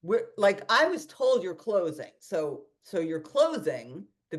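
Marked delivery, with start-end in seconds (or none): none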